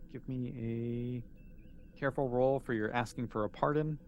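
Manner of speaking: ordinary talk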